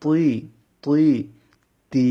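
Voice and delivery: "প" and "তুই" heavy, "তা" and "তি" light; letter drill. Shown as a demonstration. Teacher drilling heavy and light letters for students. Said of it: "এই অক্ষরটা ভালোভাবে লক্ষ্য করুন".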